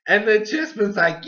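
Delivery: ordinary talk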